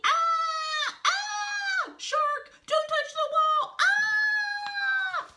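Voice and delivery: very high, loud cartoon screams; high-pitched screamed warning; terrified screaming. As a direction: shriek in panic while falling. Falling characters scream.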